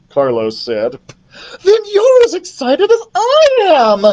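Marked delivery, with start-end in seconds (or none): 1.6-4.1 loud, very high squeal; excited queen. Acted. Queen insists son is excited too, thrilled. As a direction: squeal it with delight.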